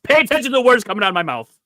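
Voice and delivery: loud, high, rapid telling-off; exasperated scolding. Real, not acted. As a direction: scold him fast and loud for not listening. Mock-scolding a co-host who missed the question.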